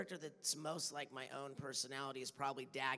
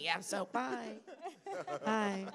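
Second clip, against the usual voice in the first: voicing Daggett; 7 semitones higher, 4 dB louder, slower than usual. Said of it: "that's pretty much me".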